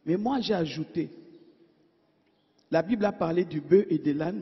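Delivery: ordinary talk, nothing marked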